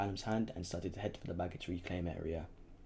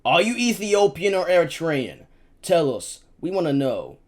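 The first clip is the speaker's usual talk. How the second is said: loud, much higher barked demand; shouting soldier; 9 semitones higher, 16 dB louder than usual. A narrator's reading. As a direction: yell the question over gunfire. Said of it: "The soldier continued shooting".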